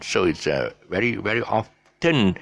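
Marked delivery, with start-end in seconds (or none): 1.4-2.4 "often" as OFF-ten, drawn apart; T sounded. Shown as a demonstration. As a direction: mistaken hard T in "often". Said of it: "this mistake by saying often".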